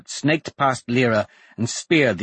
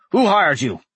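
angry; 6 semitones higher, 5 dB louder than usual. Acted. Furious Dante interrogates the assassin.

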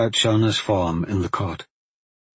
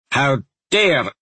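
higher, indignant cut-off cry; angry outburst; 5 semitones higher, 4 dB louder than usual. A narrator's reading. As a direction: break off in raised-voice outrage. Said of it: "the woman growled angrily".

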